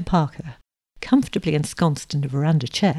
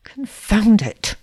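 slow, drawn-out, exasperated curse; grumbling; 4 semitones higher, 4 dB louder, much slower than usual. A narrator's reading. Suitor resents being taken rowing, grumbling. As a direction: grumble it slowly, higher than usual. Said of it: "he grumbled under his breath".

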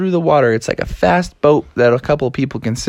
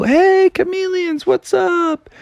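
high hollered hello; calling out greeting. Acted. Frog family greets chameleons from the car, high holler. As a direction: call across to friends.

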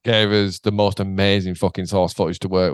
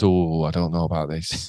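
doubtful warning; 8 semitones lower than usual. Retold or imitated. Voicing a doubtful orange warning button.

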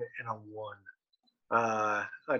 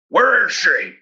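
loud, pitched-up menacing interrogator; 8 semitones higher, 8 dB louder, slower than usual. Acted. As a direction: shout it like an over-the-top intimidating vigilante.

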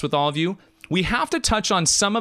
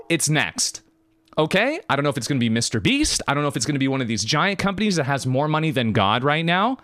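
usual speaking voice

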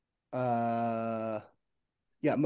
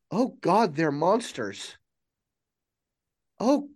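horrified; 5 semitones higher, slower than usual. Acted. AI horrified realization.

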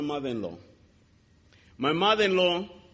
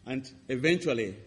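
normal talking voice

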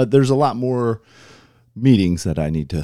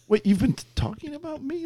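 shocked realization. Retold or imitated. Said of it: "you're like".

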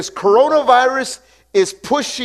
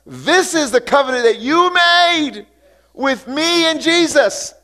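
a believer praying boldly over a situation, raised voice; bold prayer to the Father; 7 semitones higher, 3 dB louder than usual. Pretend. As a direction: claim the covenant before God, lifted and firm. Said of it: "speak the word ... and say".

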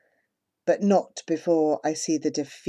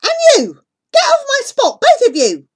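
loud, much higher furious yelling; angry shout; 9 dB louder than usual. Acted. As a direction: drive them off your spot. Boy bullying, shouting.

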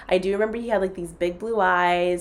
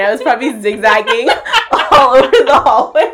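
laughing, louder and higher; 5 semitones higher, 10 dB louder than usual. Real, not acted. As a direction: giggle through it. Laughing about drunk zigzag walk.

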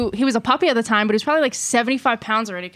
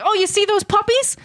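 lightly voiced higher-pitched chirp; imitated dog runner; 7 semitones higher than usual. Runner stops to talk to his dog, lightly imitated. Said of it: "and he's like".

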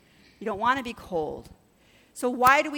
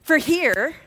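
loud, higher-pitched mock sneer; nasty, condescending; 7 semitones higher, 7 dB louder than usual. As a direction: imitate a snotty, condescending reply. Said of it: "in a really nasty, condescending way".

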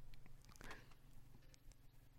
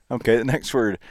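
ordinary talk, nothing marked

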